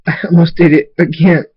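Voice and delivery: distraught, breaking into tears; crying. A narrator's reading. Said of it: "starts crying and says".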